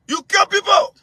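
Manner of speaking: furious on-air accusation; angry shout. Real, not acted. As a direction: shout the charge at him.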